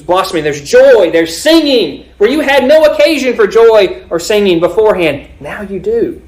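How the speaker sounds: high, exultant pulpit delivery; forceful preaching. Acted. Preacher telling believers they now have joy and singing, forceful preaching.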